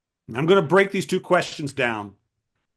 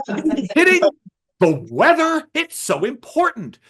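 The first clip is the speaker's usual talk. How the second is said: higher, eager and insistent about the weather; passionate author; 6 semitones higher than usual. Retold or imitated. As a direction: gush like it's the most fascinating thing alive. Friend defends his weather book.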